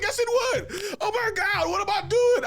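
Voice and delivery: very high, loud, laughing alarm; laughing panic. Retold or imitated. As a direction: squeal in mock horror. Panicking at own thought.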